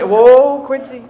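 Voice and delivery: loud mocking "Whoa" that drops away; a gossip's fake shock; mock gasp. Gossip mocking Quincy behind her back, loud fake gasp.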